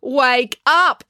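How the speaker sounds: sharp, loud wake-up call. Acted. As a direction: rouse the sleepers loudly. April waking the boys.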